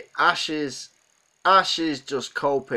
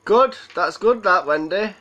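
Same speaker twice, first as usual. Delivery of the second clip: excited approval; 7 semitones higher, 7 dB louder than usual. Real, not acted. Reacting to a chat comment.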